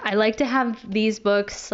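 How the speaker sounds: ordinary delivery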